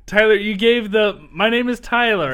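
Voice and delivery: put-on kid game show cadence. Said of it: "in the exact same cadence".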